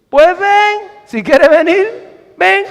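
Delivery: high-pitched "come on, then"; offhand invitation. Acted. Acting Jesus's casual invitation.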